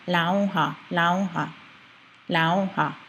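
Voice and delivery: "lauha" as LAU-ha with a clear l; L sound substitute. Shown as a demonstration. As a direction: drill "lauha", tongue on the palate for l. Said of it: "with the L sound first".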